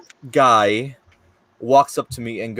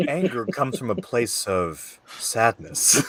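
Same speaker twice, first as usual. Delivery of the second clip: mock profound; 3 semitones lower, 6 dB quieter than usual. Mock Anno philosophy debate.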